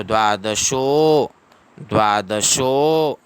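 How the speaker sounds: "द्वादशो" with the final sho held about two seconds; slow "द्वादशो", swelling on a stretched sho; long-held sho. Shown as a demonstration. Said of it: "शो को दो second का समय देना है".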